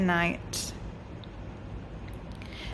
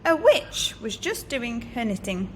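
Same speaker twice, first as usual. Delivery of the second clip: ordinary delivery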